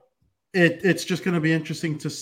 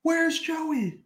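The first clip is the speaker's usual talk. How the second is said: even higher squeaky Where's Joey; high-pitched. Pretend. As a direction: pitch way up, mock panic. Host calls out for missing cohost.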